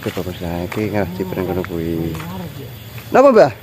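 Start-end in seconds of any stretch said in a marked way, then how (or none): 3.1-3.5 high, loud call across the field. Real, not acted. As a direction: call out to someone far off. Calling out to grandma. (bengok nyeluk Mbah)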